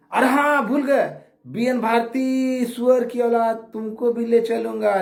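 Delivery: high, drawn-out jeering call; taunting call-out. Real, not acted. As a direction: call out the insult high and stretched. Taunting an opponent, calling out. (ऊँची, खींची आवाज़ में ललकार)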